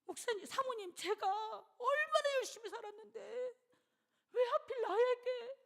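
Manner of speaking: high, loud, slowed tearful plea; imitating a weeping cry of despair; retold sob-laden lament. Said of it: "막 우는 거예요".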